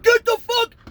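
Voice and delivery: high, loud imitated shout; urgent yell. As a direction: yell a friend off someone. Friend shouting to stop him.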